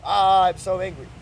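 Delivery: slow, loud mock yell; demo yell. Shown as a demonstration. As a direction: let out a hammed-up angry yell. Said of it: "We'll yell at the world".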